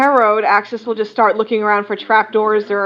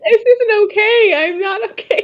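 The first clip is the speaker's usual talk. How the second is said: real squeaky, much higher outburst; high-pitched distress; 11 semitones higher than usual. Real, not acted. Player overcome by the orphan joke, high-pitched distress.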